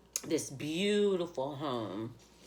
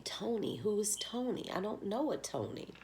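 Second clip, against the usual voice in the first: higher, puzzled repeating; wondering aloud about the dream's message, higher; puzzled asking; 8 semitones higher than usual. Retold or imitated. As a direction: keep asking, lightly baffled. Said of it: "but I kept saying".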